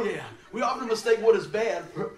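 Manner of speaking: ordinary talk, nothing marked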